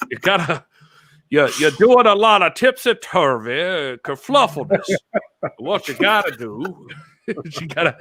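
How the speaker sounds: put-on high southern old-timer voice; folksy veteran handing out advice, hammed-up; Nick Bockwinkel. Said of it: "How did Nick Bockwinkel sound".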